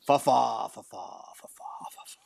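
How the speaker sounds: fa fa fading into a whisper; breathy nonsense syllables trailing off; silly whisper. Pretend. Co-host clowning on air, whispered nonsense syllables. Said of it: "should I try saying this".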